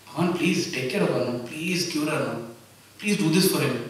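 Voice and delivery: hushed plea, leaning on each please; pleading prayer. Acted. Devotee praying to God for someone else, softly pleading.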